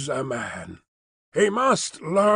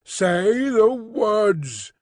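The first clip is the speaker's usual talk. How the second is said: very slow, higher, commanding; louder command; 6 semitones higher, much slower than usual. A narrator's reading. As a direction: demand it, dragging each word. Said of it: "repeated in a louder tone".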